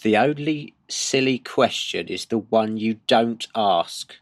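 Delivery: slow, deliberate delivery. Shown as a demonstration. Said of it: "Oh, that's excellent".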